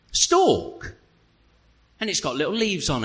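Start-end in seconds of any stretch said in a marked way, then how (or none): 0.1-0.9 amazed. Real, not acted. Amazement at new growth.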